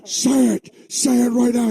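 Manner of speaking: high mock yelling father; demanding yell. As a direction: shout the order up high. Father demanding in acted scene.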